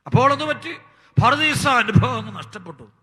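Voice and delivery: high, loud pulpit fervour; fiery preaching. Acted. Preacher warning the congregation about Satan, fiery preaching. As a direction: thunder it from the pulpit.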